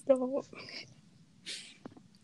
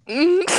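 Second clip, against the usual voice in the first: short high moan, louder; raised, louder "mm" like a moan; retold accidental moan; 7 semitones higher, 7 dB louder than usual. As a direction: imitate an accidental sleepy moan. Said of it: "Did you just moan?".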